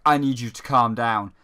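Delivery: low, flat, bored hero voice; deadpan low monotone mockery; monotone character impression. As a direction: drone it like an unbothered game character. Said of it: "same monotone voice".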